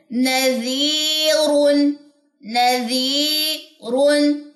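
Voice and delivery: نذیرن with the ee stretched very slowly and vanishing; slow recitation drill. Shown as a demonstration. Teacher recites slowly for students to repeat. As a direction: chant نذیرن slowly, fading into air. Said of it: "ہوا میں غائب کریں گے".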